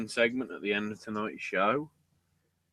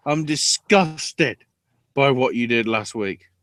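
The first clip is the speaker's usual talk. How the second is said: louder, higher, slow hammed-up scold of a father; mock angry quote; 5 semitones higher, 8 dB louder, slower than usual. Retelling the daughter berating her father, a hammed-up angry rant. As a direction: act out the daughter's furious speech. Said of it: "And then goes".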